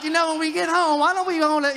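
high, loud imitation of a wife; chattering wife. Wife to husband riding at sunset, chattering high and loud. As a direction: prattle loudly from the back of the bike.

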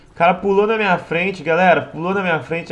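indignant outburst. Real, not acted.

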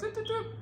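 high-pitched little doop noises; playful sound effect. Retold or imitated. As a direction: mime unlocking with tiny high blips. Imitates unlocking the doors.

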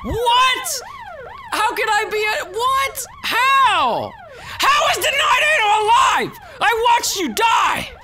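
high, loud disbelieving yell; cracking cry of disbelief; shocked shout. Real, not acted. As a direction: scream in outraged disbelief. Outraged disbelief that enemy lives.

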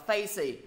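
"facey" ending on a bare "see"; missing final sound. Shown as a demonstration. Teacher shows a misleading mistake, "facey" lacking its z. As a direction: leave the z off the end of "facey". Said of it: "mọi người bị thiếu một âm".

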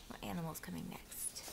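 low whispered question; hushed, confiding question; whispered aside. Real, not acted. Quiet aside to the listeners.

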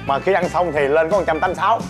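usual speaking voice

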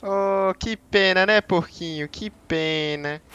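high cooing voice, mocking the piggy; baby-talk sympathy, lightly squeaky; mock pity. Gamer coos fake sorrow at the dead pig, high baby talk.

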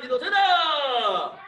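drawn-out counting call; sharp martial arts commands. Real, not acted. Instructor calling the kick count.

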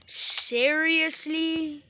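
long, loud, high "Seriously?" in disbelief. Real, not acted. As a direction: stretch out "Seriously?" with outrage.

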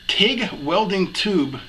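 slow, careful tongue-twister delivery; careful enunciation. Real, not acted. Host getting a tricky title out, slow and careful. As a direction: pick through each word slowly. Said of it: "got those out without, uh, chewing those words up".

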